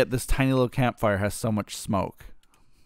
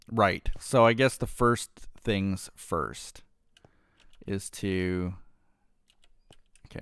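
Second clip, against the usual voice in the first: ordinary talk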